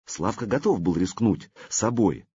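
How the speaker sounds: plain reading voice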